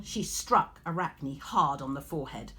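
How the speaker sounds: ordinary delivery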